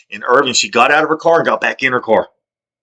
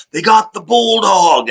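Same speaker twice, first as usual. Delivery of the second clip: announcing; 9 semitones higher, slower than usual. Retold or imitated. Quoting someone's announcement.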